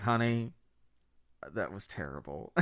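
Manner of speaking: very low, soft confession; low, deadpan aside; hushed verdict. Retold or imitated. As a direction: murmur it gravely to your partner. Confiding verdict to wife at end.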